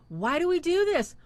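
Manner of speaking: usual speaking voice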